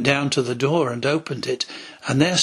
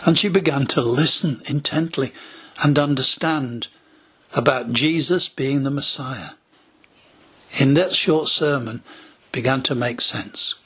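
usual speaking voice

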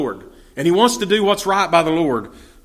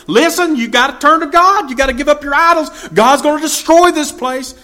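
much higher and louder, urgent street preaching; prophet's warning shout; 11 semitones higher, 6 dB louder than usual. Retold or imitated. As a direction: call the crowd to repent at full voice. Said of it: "He's standing up there telling them".

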